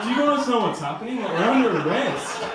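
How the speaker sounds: high-pitched comic disbelief; incredulous. Acted. Comic to two chummy cellmates, incredulous. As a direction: exclaim it in baffled protest, voice way up.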